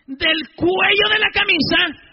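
loud, high and slow, raging order; retold furious priest giving orders; furious command. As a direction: bark the order at the gang leader. Priest retells ordering gang leader.

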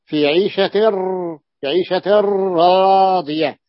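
في عيشة راضية very slow with the tanwin merged into ra; عيشة راضية read slowly, doubled ra; slow recited example. Shown as a demonstration. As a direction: stretch عيشة راضية, no nun before ra. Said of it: "أليس كذلك".

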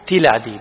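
تلادي pronounced "tilādī" with short i; kasra on the tā'. Shown as a demonstration. Teacher answering which vowel is preserved, تلادي pronounced "tilādī". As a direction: give تلادي a clear "ti" at the start. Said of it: "بكسر التاء".

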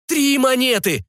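high, drawn-out plea; desperate offer. Acted. Barker making a last offer to a departing client, desperate. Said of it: "в отчаянии предложил зазывало".

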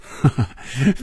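short character chuckle; laugh. A narrator's reading. Hongjun laughing off her disbelief, short chuckle. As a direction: chuckle before answering. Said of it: "红军笑着说".

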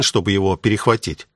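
usual speaking voice

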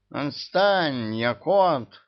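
high shrill squeal of protest; shrieking. A narrator's reading. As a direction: squeal it out in a panic. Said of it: "заверещал Мурзик".

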